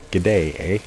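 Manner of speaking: lightly put-on Canadian sign-off; mock Canadian. Echoing his buddy's note in a light mock Canadian accent. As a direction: toss off a hint of a Canadian accent. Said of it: "[name] doing his impersonation of a Canadian".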